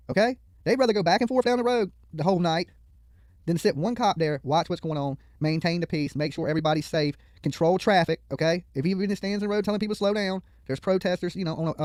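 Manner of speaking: ordinary talk, nothing marked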